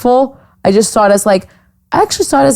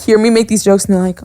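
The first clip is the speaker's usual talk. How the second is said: ordinary delivery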